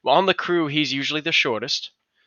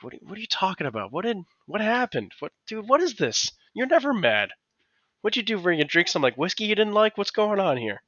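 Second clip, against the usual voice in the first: pitched way up, baffled and fast; can't believe the nice guy is mad; incredulous high disbelief; 9 semitones higher than usual. Retold or imitated. Incredulous reaction to fuming Mark.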